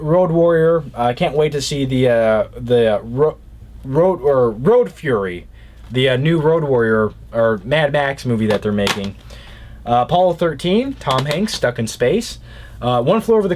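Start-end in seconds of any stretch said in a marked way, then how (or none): none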